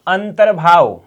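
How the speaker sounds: ordinary talk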